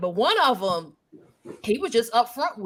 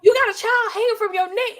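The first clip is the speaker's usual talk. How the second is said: incredulous; 10 semitones higher, 5 dB louder than usual. Retold or imitated. Incredulous retort retold.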